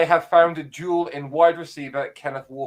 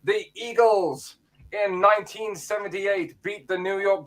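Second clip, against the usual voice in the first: a touch higher, announcer-style history read; segment presenter voice; 4 semitones higher than usual. Acted. [name] does his weekly history feature for the podcast, announcer-style. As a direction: present it like a radio feature, lightly. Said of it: "time for your voice, mate".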